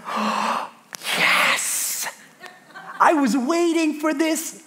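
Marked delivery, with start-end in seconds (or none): 0.0-2.1 breathless whispered "Oh, yes!"; thrilled but hushed, almost a laugh; whispered delight. Retold or imitated. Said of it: "I was like".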